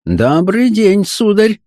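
high-pitched, haughty madame; stately greeting. A narrator's reading. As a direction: greet an unwelcome guest grandly from on high. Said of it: "приняв величественную позу".

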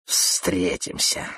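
slow, whispered menace; hissed threat. A narrator's reading. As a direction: hiss a parting threat under your breath. Spitting rival's parting threat. (угрожающее протяжное шипение)

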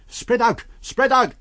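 high, loud yell repeated; shouted command. A narrator's reading. A pursuer directing the crowd, loud shout. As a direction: bark the order to the crowd. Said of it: "cried someone".

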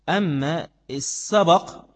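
slow "السبق" with the open b: as-sa-baq; sabaq, slowly. Shown as a demonstration. Teacher stressing the prize sense, slow "السبق". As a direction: stretch "السبق" out with the fatha. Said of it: "بفتحها بمعنى الجعل".

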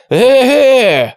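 long high yell; drawn-out, high, loud holler. A narrator's reading. Gang leader alarmed by the ambush, yelling. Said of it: "заорал Квакин".

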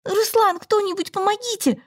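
high, panicked call to Ruslan; desperate cry for help. A narrator's reading. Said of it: "крик подруги".

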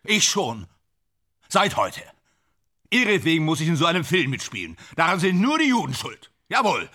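high, worked-up ranting. Acted. Leiser, bitte.

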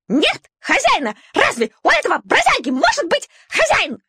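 high, piercing shriek with NEVER-like weight on нет; piercing squeal. A narrator's reading. Shaiba shrieking to the dogs about the puppy, piercing. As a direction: screech it like a spiteful lapdog. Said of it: "пронзительно взвизгнула Шайба".

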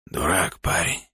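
hoarse, soft rasping whisper; wheezy voice. A narrator's reading. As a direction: wheeze it out, exhausted. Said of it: "сипло сказал Илья".

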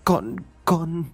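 high, faltering stammer; terrified mumbling. A narrator's reading. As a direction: falter helplessly, too scared to finish. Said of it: "cô chỉ biết ú ớ".

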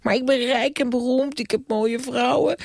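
put-on very high, fast indignant boy; childish Bram. Bram brushing off the ghost, very high childish voice. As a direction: squeak it back like a defiant kid.

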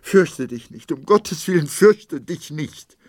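high, loud, pleading; wife frantically reassures her husband; frantic reassurance. A narrator's reading. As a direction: beg him not to be afraid. Wife begs husband not to fear.

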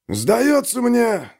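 slow, high, weary decision; gruff sergeant voice. Acted. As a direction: say it like a tired soldier. Sergeant decides calmly.

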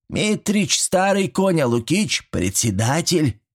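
high, slow granny voice; old woman explaining. A narrator's reading. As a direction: spell it out slowly as a pert granny. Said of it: "пояснила бабка".

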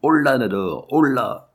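mild rallying call to a dog; encouraging call. A narrator's reading. Trainer coaxing his dog toward the hoop, encouraging call. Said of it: "en lui disant pour l'encourager".

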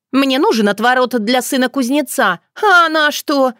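high, indignant blurt. A narrator's reading. Dunya demanding a potion from the yaga, blurted indignantly. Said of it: "прищурилась и выпалила".

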